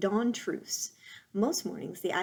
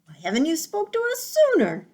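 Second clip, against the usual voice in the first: high, loud owl screech; 9 semitones higher, 9 dB louder, faster than usual. Acted. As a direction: screech down from the tree. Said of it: "screeched the owl".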